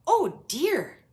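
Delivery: high, loud startled gasp. A narrator's reading. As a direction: react to the bang. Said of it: "gasped Grandpa Pig".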